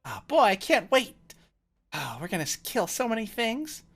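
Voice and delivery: high, loud, thrilled newcomer; bursting with glee about the coming adventure; excitable adventurer. Acted. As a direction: squeal it like an overexcited kid. Game master plays eager recruit.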